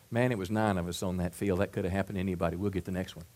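low, soft reassurance; acted consoling. Teammate consoling the player who struck out, soft and low. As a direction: let the player off gently, voice dropped.

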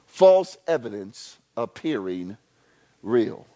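FALSE hit hard, each word spaced; slow spelled-out acrostic. Shown as a demonstration. Preacher spelling out the fear acrostic, slow and deliberate.

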